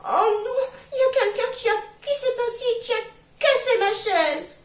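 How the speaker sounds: very high, loud and sobbing like a crying cub; little bear's tiny voice. Acted. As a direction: whimper it out like a heartbroken baby bear. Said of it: "de sa toute petite voix dit".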